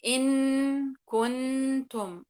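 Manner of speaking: "إن كنتم" very slow and loud, every nun pronounced clearly; wrong clear nun. Shown as a demonstration. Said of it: "أقرأها إظهار". (إظهار خاطئ للنون ببطء شديد)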